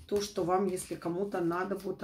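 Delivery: normal talking voice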